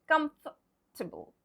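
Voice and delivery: COMFORTABLE as KUMF-tuh-bul; silent O R. Shown as a demonstration. Teacher repeating the rule, COMFORTABLE as KUMF-tuh-bul. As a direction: say COMFORTABLE with a bare F, no OR. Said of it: "no O R".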